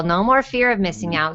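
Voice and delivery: ordinary talk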